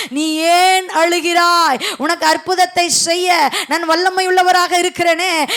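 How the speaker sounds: forceful promises in God's voice, steadily building; impassioned preaching. Acted. Preacher speaking as God to a weeping believer, driving preaching. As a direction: hammer out each promise with mounting force.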